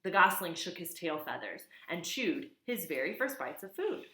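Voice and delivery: usual speaking voice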